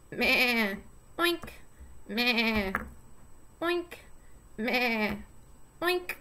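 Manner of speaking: playful animal sound pattern; sheep and pig sounds. Shown as a demonstration. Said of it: "using the sounds instead of saying".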